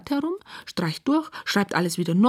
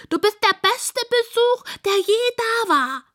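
very high, overjoyed pig squeal; shrieky little pig delight; 3 dB louder than usual. A narrator's reading. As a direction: squeal it with joy, stretching the end. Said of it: "quiekt sie".

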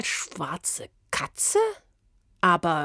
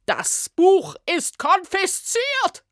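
high, loud, slow stern order; stern decree; 12 semitones higher, 5 dB louder, slower than usual. Acted. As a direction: bark out the order. Official confiscating the book. (Beamtin verkündet streng die Beschlagnahme, hoch und laut)

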